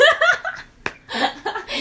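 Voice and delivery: high squealing laugh; burst of laughter. Real, not acted. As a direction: crack up at your own slip. Laughing at own slip.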